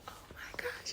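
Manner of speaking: unvoiced hushed gasp of wonder; awed whisper. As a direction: whisper it like you can't believe your eyes. First sight of baby's pictures.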